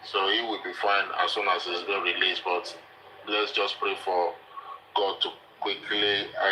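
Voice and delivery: usual speaking voice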